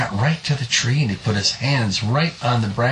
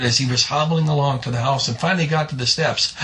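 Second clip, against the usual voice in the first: plain reading voice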